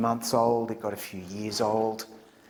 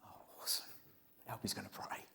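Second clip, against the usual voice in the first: hushed whisper to friends; whispered excitement; 18 dB quieter than usual. Retold or imitated. Friends in the prayer circle, whispered excitement. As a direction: whisper it eagerly under your breath. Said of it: "we're sitting going".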